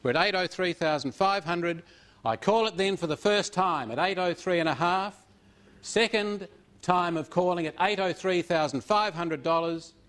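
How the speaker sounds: usual speaking voice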